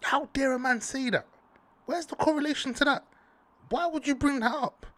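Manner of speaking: outraged disbelief. Real, not acted. Outraged at the tweet.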